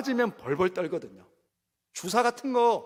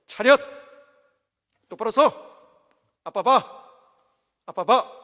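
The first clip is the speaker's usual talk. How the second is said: a firm, slightly louder mock scolding of a child; stern father commands; 4 dB louder than usual. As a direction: bark short orders like a strict dad. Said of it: "딱 무섭게 노래 보면서".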